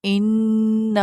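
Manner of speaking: "من" with the doubled نون held long in the nose; held nasal ghunna. Shown as a demonstration. Teacher demonstrating doubled noon for students, nasal hum. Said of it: "میم کی sound دیکھیں کس طرح آ رہی ہے".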